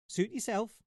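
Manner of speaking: high, wondering mouse squeak; a curious little mouse pipes up; high puzzled mouse voice; squeaky little mouse. Acted. Mouse voice in story.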